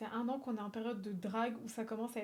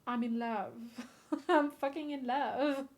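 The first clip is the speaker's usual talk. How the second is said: swooning; much slower than usual. Real, not acted. Swooning over the photo.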